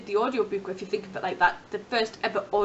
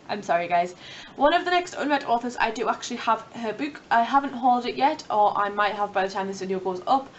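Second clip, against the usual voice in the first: ordinary talk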